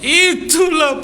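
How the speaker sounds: drawn-out high slow wail; mock wail. Widow wailing again at visitors, slow high mock wail. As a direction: stretch it into a mock lament.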